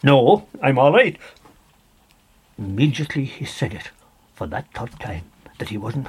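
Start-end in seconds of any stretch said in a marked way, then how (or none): 0.0-1.2 louder, tense no; nervous refusal. Acted. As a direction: hold firm though scared. Dennis refuses a third time.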